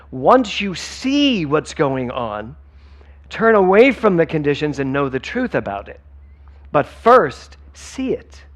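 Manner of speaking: slow emphatic quote. Shown as a demonstration. Quoting Holmes properly, emphatic.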